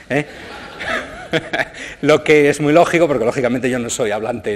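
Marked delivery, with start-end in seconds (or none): 0.3-2.0 breathy burst of laughter; real laugh. Real, not acted. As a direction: laugh out at the result. Laughs at the app's answer. (risa espontánea)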